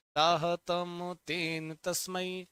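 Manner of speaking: slow, deliberate chant of साहतम तेन तस्मै; slow recitation. Shown as a demonstration. Teacher prompting students to repeat, chanting slowly. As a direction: lead the class through it slowly. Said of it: "एक वचन कैसे चलेगा".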